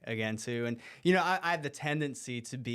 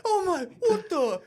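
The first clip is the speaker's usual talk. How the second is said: laughing mock panic, pitched way up; imitating a freaked-out surfer; 8 dB louder than usual. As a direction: play the hysterical stranger over the top. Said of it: "He's like".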